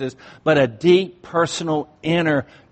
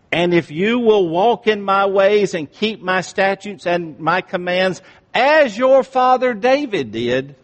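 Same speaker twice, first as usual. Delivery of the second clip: full-force sermon voice; fiery preaching; 7 semitones higher, 7 dB louder than usual. Acted. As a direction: preach it hard to the congregation.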